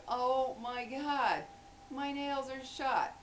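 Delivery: high, drawn-out mock dismay; acted dismay. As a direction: draw it out like a small disaster.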